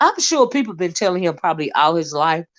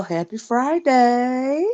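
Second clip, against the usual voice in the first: slow, higher, stretched-out greeting; drawn-out greeting; 4 semitones higher, much slower than usual. Real, not acted. Vlogger greets viewers on a Friday, drawn-out greeting. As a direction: draw the words right out, rising at the end.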